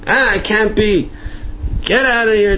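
high-pitched mock irritation; acted-out exasperated refusal; scornful dismissal. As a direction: brush it off and order him out. Retold Ziskin refuses message again.

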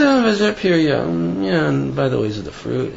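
mumbled, throwaway acknowledgement; slurred. Acted. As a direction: trail off indistinctly as if glossing over it. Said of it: "the nice things you kinda slurred".

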